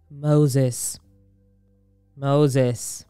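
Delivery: low, echoing voice from the bush; voice of God. Acted. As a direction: call out slowly with solemn power. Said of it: "a voice called from the bush".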